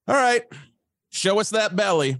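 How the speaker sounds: high, loud imitated command; calling out. Guy behind the camera to auditioning actor, loud high call. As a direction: holler it across the room. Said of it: "the guy behind the camera says".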